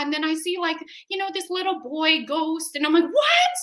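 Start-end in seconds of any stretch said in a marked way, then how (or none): 3.1-3.6 very high, loud disbelieving squeal; hammed-up astonished yelp; mock shock. Hearing of a little boy ghost, mock shocked.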